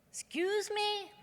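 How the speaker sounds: very high, slow "Excuse me?"; waitress can't believe what she heard; incredulous waitress. Retold or imitated. Waitress hearing the snobby question, incredulous and high. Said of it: "And she said".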